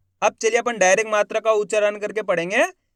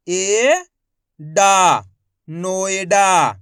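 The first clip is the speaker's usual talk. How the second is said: loud syllable reading drill; 5 dB louder, much slower than usual. Shown as a demonstration.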